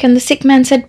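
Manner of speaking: ordinary talk, nothing marked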